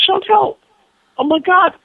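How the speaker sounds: high, loud startled call; startled alarm. Retold or imitated. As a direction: cry out to a companion in shock. Retold shock at vanished apparition.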